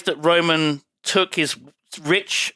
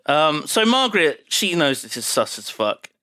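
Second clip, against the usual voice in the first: ordinary talk, nothing marked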